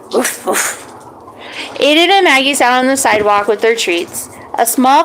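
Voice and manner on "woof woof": breathy whispered woof; a quiet little dog bark; soft dog bark. Acted. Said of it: "Maggie barked as if to say yes".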